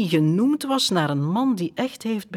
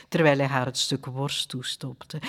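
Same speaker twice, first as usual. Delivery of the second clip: usual speaking voice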